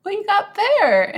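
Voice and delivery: high, loud mock question; playful imitation of George asking what's there; quoting George. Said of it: "And he's just like".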